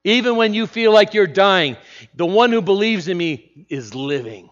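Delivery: emphatic preaching. Real, not acted.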